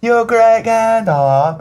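high, drawn-out mock British accent; hammed-up British accent, stretching the words; weird British accent. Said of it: "did a weird British accent the entire time".

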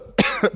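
sudden cough; choking cough. Real, not acted. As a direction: cough as a drink goes down wrong. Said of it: "something went down the wrong way".

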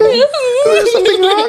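squeaky high laughing voice, slow; high giggly squeal. Real, not acted. Tipsy guest jokes about wine.

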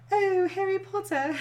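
badly faked British accent, high; mock a spotty British accent; imitating a bad British accent reader; fake British accent. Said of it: "a fake British accent".

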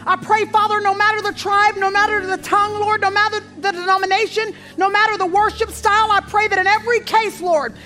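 declarative, rhythmic church prayer at full projection; fervent public prayer. Real, not acted. Preacher praying over pastors and churches worldwide, fervent. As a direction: pray it out strongly, phrase upon driving phrase.

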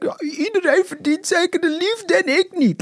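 a hint of crying, hammed-up drama; mildly teary, self-mocking; tearful. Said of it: "ik begon een beetje te huilen".

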